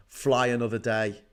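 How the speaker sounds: ordinary delivery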